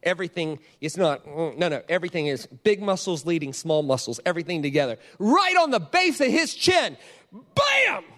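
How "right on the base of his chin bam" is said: high, slow, emphatic build to a yelled impact; punchline climax. Retold or imitated. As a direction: land each word like the blow, then explode. Climax of the knockout punch story.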